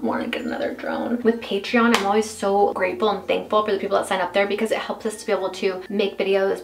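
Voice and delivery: usual speaking voice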